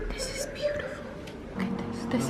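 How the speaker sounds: hushed in awe; awed whisper. Real, not acted. Shopper awed by a treasure, whispering. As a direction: whisper as if in a museum.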